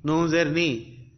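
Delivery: ordinary delivery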